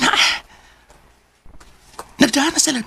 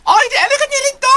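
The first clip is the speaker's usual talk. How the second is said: much higher, fast and loud; excited high call; 14 semitones higher, 4 dB louder, faster than usual. Acted. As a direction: call out eagerly. Driver calls out.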